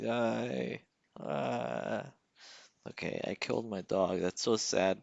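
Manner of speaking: normal talking voice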